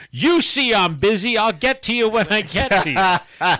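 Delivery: imitated waitress snapping back, high and loud; calling across restaurant. Waitress shouting to a customer from across the restaurant, mock holler. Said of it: "the waitress from across the restaurant says".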